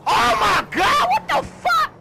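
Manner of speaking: high, loud shout of shock; slow, stunned yell at a sudden surprise. Real, not acted. Gamer shocked by a surprise in the game, shouting.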